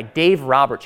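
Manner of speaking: ordinary talk, nothing marked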